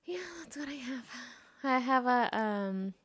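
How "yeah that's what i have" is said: whispering. Real, not acted. Trailing off after topic.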